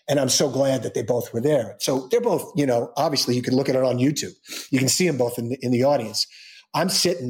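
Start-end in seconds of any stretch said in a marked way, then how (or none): none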